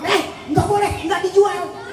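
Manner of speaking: high, abrupt motherly refusal; scolding mother. Acted. Mother refusing crying child a treat, scolding.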